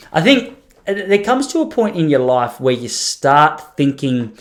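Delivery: ordinary delivery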